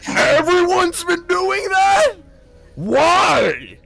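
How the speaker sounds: very high slow squawk of disbelief; outraged grandpa. Retold or imitated. Old god learning everyone casts his magic, high squawk. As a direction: shriek it like a stunned old man. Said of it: "he goes".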